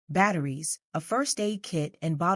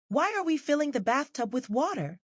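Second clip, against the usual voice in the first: put-on child voice; 8 semitones higher than usual. Child asking mom.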